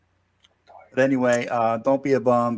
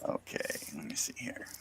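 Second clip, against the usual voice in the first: whispering; 8 semitones lower, 17 dB quieter than usual. Real, not acted. Aside while checking chat.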